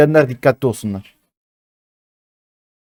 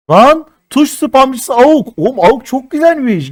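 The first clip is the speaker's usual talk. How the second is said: high, slow delighted cry; thrilled gamer gushing; 10 semitones higher, 4 dB louder, slower than usual. Real, not acted. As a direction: savour the new skin out loud. Delighted by new skin.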